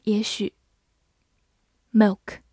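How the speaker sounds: ordinary talk, nothing marked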